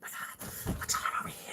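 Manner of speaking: hushed, jittery whisper; mock nervous whisper. Host mocking how visitors act near structures, nervous whisper. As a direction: whisper it like a spooked newbie. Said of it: "Don't start going".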